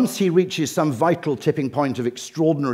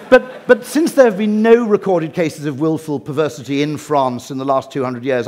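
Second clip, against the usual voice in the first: usual speaking voice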